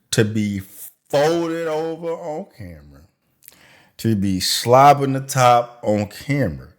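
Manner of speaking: ordinary talk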